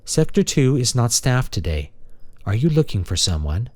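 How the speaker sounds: a courteous maintenance machine; friendly robot. Acted. Robot character speaking.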